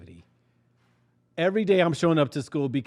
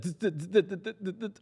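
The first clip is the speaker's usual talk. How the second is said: mocking stutter; 5 semitones higher than usual. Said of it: "She's like".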